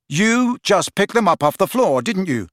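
high, heated accusation; accusing. Acted. Friend grilling dangling mate, accusing. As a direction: press the accusation hard. Harry accuses in shouting quarrel.

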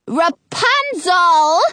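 long, high yell of the name; drawn-out, high summons. A narrator's reading. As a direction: yell up to the window, stretching the name. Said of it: "Frau Gothel yells".

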